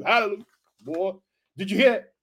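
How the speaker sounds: ordinary delivery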